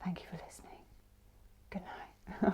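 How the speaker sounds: very soft, whispery imitation of a talk-down host; hushed, mock meditation-guide voice; imitated sleep-guide sign-off. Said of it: "and they'd go".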